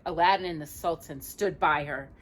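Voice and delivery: ordinary delivery, nothing marked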